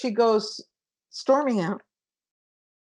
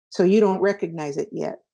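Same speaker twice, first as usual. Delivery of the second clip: usual speaking voice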